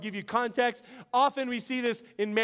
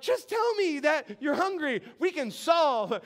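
higher-pitched comic pleading; exasperated husband; 5 semitones higher than usual. Retold or imitated. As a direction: beg in mock exasperation. Acts exasperated husband to wife.

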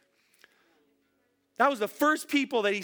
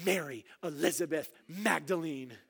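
low, soft full-name scolding; a parent's low warning, naming in full; stern full name; 5 semitones lower, 5 dB quieter than usual. Retold or imitated. Preacher imagining Jesus scolding Mary, low and stern. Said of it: "he doesn't say".

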